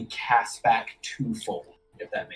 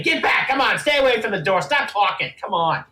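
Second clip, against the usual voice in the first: high, loud, rapid commands; acted sharp shouting; guards barking orders; 9 semitones higher, 5 dB louder than usual. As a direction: order a prisoner back. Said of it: "poke them in the cage and say".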